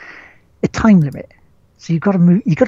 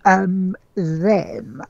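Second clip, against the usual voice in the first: ordinary talk, nothing marked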